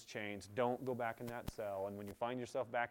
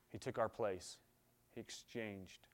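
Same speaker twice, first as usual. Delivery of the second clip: ordinary delivery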